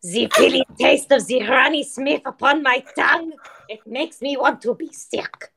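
a hint of a hammed-up Frenchman; French accent. Tasting Granny Smith apples, mock French accent.

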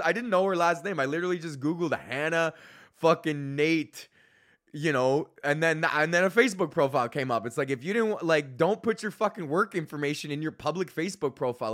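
ordinary delivery

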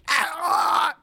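high loud cry as the knife hits; stabbed guard shouting; pained yell. Acted. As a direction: yelp from a stab in the neck. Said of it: "And he goes".

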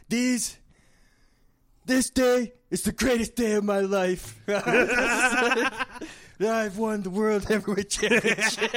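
high, excited wrestler hype; wrestling promo. Pretend. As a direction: cut a triumphant wrestling promo. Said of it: "start doing a wrestling promo".

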